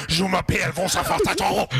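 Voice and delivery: slow, sing-song mock French accent; lightly hammed French accent. Mocking French speech.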